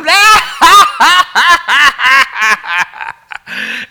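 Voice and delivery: high, loud mocking laughter; imitated mocking laugh. Friend laughing at him soaked in mud, high loud laugh. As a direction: cackle like a friend watching you fall. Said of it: "he's in the back going".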